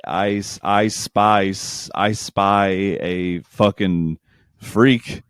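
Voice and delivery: slow, drawn-out pun; mock roast. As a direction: spell the pun out slowly for effect. Mock roast punning [name].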